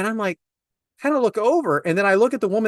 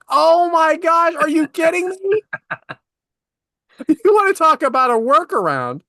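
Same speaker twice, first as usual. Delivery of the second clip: exasperated disbelief; 8 semitones higher, 4 dB louder than usual. Real, not acted. Exasperated at helpless shoppers.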